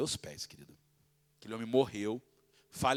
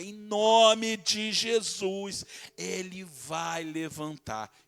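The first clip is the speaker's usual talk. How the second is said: very slow, drawn-out loud proclamation; bold declaration; 5 semitones higher, 6 dB louder, much slower than usual. Retold or imitated. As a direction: proclaim it over the dying man. Declaring healing in Jesus' name.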